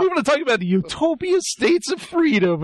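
imitated eager recruiter; mock pitch. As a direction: announce the new nation with mock importance. Acted recruiting pitch restarted.

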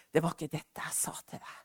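God's voice, softly whispered; a hushed inner voice correcting her. Retold or imitated. The Lord gently correcting the preacher, whispered.

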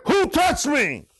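mild mock scream from the pulpit; retold scream. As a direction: call it out high and slow. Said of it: "Jesus screamed out, he said".

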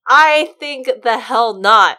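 slow, very loud mock bellow; loud refusal. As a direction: drag it out and bellow it like a proud king. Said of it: "Just like".

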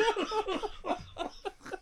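high-pitched helpless laughter; high laughing fit. Real, not acted. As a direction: wheeze with laughter.

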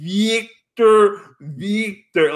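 imitating Chekhov's Russian accent, high-pitched on the first Victor; mock Chekhov, Russian accent, repeating Victor. Chekhov failing to be understood by the computer, Russian accent. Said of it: "He goes".

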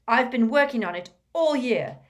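put-on boy defending his year of effort; vehement. A narrator's reading. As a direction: press on, near shouting. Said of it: "said Malfoy vehemently".

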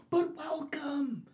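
high, slow, drawn-out welcome; Joker welcome. Retold or imitated. Joker greeting Batman at the asylum, high and slow. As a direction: stretch "welcome" high and slow like a delighted villain.

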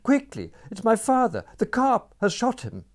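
high, loud panicked cry; frantic gabble. A narrator's reading. Girl pulling a stranger by the hand, high and frantic. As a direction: blurt it out in a frantic rush. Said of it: "she gabbled".

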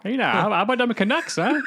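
mock-Canadian sports chat; Canadian accent. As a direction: put on a folksy Canadian. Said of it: "How, how's my Canadian accent?".

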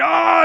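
loud, high mock yell of frustration; imitated exasperated roar; angry yell. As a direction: let out a big fed-up "Ah!". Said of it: "he's just angry".

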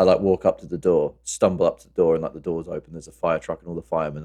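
ordinary talk, nothing marked

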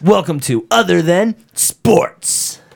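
high, loud, drawn-out arcade announcer; NBA Jam voice. Shown as a demonstration. Co-host nailing the show intro, big game announcer voice. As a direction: boom the title out slowly. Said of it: "Sega Genesis N B A Jam voice".

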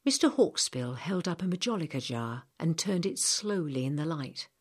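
usual speaking voice

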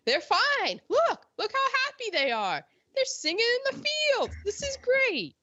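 very high fake-happy gushing; mock cheerfulness. Voicing Hollywood's happy portrayals.

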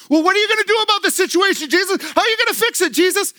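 high-pitched, hurried pestering questions; mock frantic questioning. Acts out anxious questioning of Jesus.

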